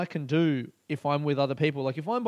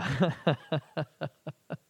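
long run of chuckles; real, helpless chuckling; laughing. Real, not acted. Comedian recalling the disastrous show, laughing. Laughs at his own story.

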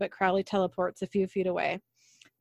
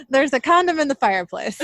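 louder, higher and giggly, still cracking up; laughing correction; 5 semitones higher, 7 dB louder than usual. Shown as a demonstration. Host fixes a flubbed recap line while laughing. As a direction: blurt out the fixed line through laughter. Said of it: "I don't know why I couldn't say that".

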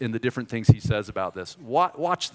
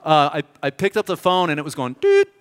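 ordinary delivery

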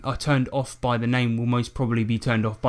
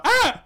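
short, very high mock scream; hammed-up high cry of fright; 4 dB louder than usual. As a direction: yelp in pretend alarm. Acting out startled street victims.

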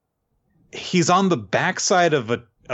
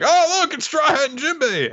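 high, excited crowd cheer. Retold or imitated. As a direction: squeal it out like an amazed bystander. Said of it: "people are reacting like".

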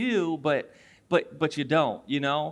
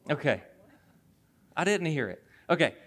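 usual speaking voice

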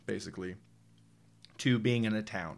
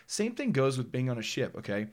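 ordinary talk, nothing marked

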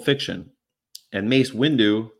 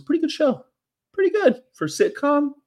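silly voice. Acted. Silly voice aside.

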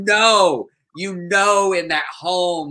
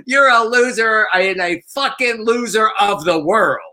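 louder, higher sputtering insult; mock yell; 5 semitones higher, 4 dB louder than usual. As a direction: blurt the insult loud and high. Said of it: "she goes".

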